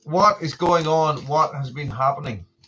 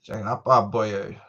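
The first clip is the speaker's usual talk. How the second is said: ordinary talk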